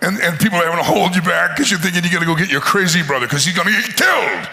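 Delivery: normal talking voice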